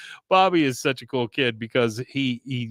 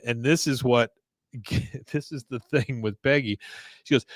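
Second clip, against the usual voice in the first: ordinary talk, nothing marked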